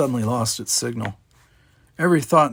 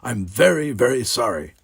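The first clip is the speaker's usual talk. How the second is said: ordinary delivery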